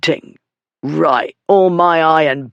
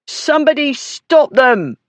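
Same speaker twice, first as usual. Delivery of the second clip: high, loud shout for help; 10 semitones higher, 3 dB louder than usual. Acted. Dog beater shouting to the crowd about thieves, high and loud. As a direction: yell to the whole inn yard. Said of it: "the dog beater shouted".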